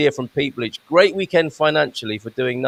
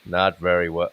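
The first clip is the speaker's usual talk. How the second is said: low, drawn-back American accent; 8 semitones lower than usual. Shown as a demonstration. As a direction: put on an American accent, pitched down. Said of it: "Can you do an American accent?".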